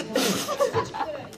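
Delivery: high peal of laughter; laughing. Real, not acted. Host offers a silly version of the phrase, laughing. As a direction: crack up at your own joke. Laughing at own joke guess.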